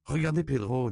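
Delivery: put-on high, loud, amused little girl; laughing child. A narrator's reading. Consuelo shows the adults sleeping Pedro, amused child voice. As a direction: point it out with lightly acted amusement. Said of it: "dit Consuelo en riant".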